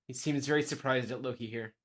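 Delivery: ordinary talk, nothing marked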